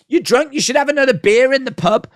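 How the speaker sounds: high mocking parody of a taunt; mocking Logan's line. Mocking Logan's pub taunt to the crowd, high parody. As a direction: squeak the insult back in ridicule. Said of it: "Like, is that".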